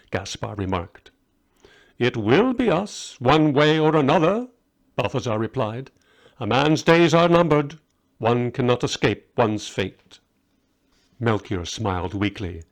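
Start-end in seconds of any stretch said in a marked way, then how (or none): 2.0-4.5 grim. Acted. Grim reply about fate. 6.4-10.2 solemn. Acted. Fatalistic pronouncement.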